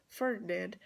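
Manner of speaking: normal talking voice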